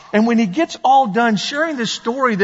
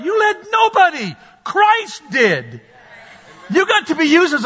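sharp, higher shout; shouted rebuke; 7 semitones higher than usual. Real, not acted. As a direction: cut them down with a shout. Preacher rebuking pride.

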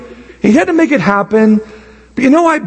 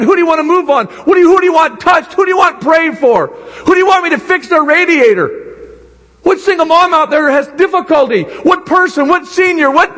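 preaching at full force; 8 semitones higher than usual. Acted.

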